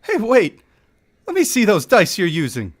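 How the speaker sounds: high, pushy dwarf bargaining; desperate gambler begging for double or nothing; eager dwarf. Acted.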